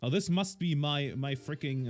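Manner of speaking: ordinary talk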